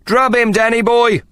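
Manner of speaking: high, loud shout from the crowd; a drunk egging on the fighter. A narrator's reading. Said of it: "someone shouted".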